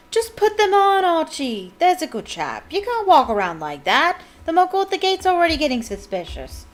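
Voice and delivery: high, loud exasperated pleading. A narrator's reading. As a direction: plead loudly, at the end of your patience. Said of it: "almost crying with exasperation".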